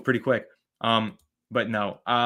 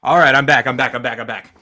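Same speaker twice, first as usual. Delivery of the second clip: panting a little, louder, back from a sprint; out of breath; 9 dB louder than usual. Real, not acted. Host back on stream after a run down the hallway, out of breath. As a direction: puff the words out after running down the hallway. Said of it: "I'm a little out of breath".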